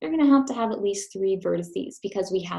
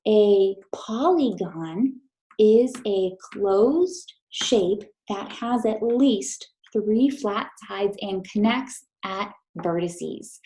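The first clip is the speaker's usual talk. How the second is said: slow, deliberate read-aloud with the class; slow choral definition; slower than usual. Shown as a demonstration. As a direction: read the definition slowly together, word by word. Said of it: "read the definition with me".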